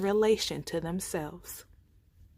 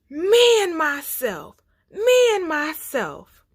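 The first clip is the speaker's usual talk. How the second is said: loud, slow, high roaring chant; lion's roar; 8 semitones higher, 8 dB louder than usual. A narrator's reading. He lion roaring to the forest, loud slow chant. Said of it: "so he roars".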